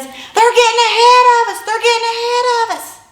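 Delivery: high, loud urgent yell. Retold or imitated. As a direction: shout it while racing forward. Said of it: "he just yells".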